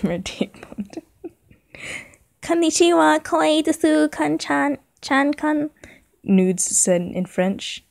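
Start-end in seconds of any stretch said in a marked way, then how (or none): 2.4-5.7 high, cutesy Japanese greeting; mock anime voice. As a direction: squeal it like a parody anime character.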